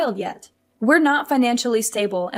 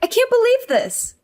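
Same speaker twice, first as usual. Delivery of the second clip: exasperated outburst; 11 semitones higher than usual. Acted. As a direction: pitch jumps high in disbelief. Wife upset over discarded clothes.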